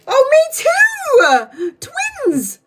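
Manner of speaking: very high shrieking, drawn out; excited squeal. Real, not acted. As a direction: shriek with joy at the match. Excited shared-memory outburst.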